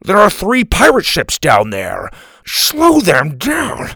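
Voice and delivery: high, loud protest, last words drawn out; exasperated, alarmed grandpa shouting; panic. A narrator's reading. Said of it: "in a panic".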